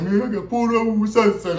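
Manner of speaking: high, eager telling; lightly voiced friend reporting in; snitching friend. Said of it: "He was like".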